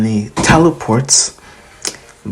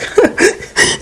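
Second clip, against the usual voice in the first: high giggling villain laugh. Retold or imitated. Shigaraki laughing at the fight like a game, high giggle. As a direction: cackle high like a gleeful villain. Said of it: "Shigaraki's just laughing like".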